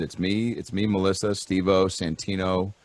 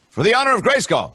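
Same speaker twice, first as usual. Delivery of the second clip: heroic cartoon cry; 12 semitones higher, 3 dB louder, faster than usual. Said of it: "Swiftwind from She-Ra".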